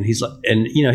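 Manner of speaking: ordinary talk, nothing marked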